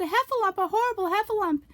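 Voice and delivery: very high, loud squeal for help; panicked cry. Acted. Piglet crying out as he flees the heffalump. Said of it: "cried Piglet".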